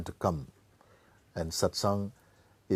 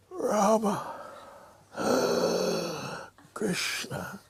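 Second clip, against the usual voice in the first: gasp, then a high strained name, then a breathy whisper; dying gasps; 13 semitones higher, 3 dB quieter, much slower than usual. Shown as a demonstration. Teacher demonstrating a dying father gasping out his sons' names. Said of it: "Like this, no?".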